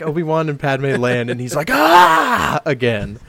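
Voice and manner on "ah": high loud yell; hammed-up scream; mock angry yell. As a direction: bellow in rage. Anakin yell.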